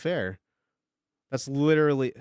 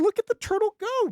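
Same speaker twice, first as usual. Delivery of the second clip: high-pitched hype; excited. Real, not acted. Streamer cheering the turtle drawing on, high and excited. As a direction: squeal it out with glee.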